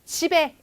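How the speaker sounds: "집에" run together as ji-be; linked pronunciation. Shown as a demonstration. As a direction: carry the ㅂ of "집에" into the next vowel. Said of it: "연음 현상에 따른 그런 발음 방법".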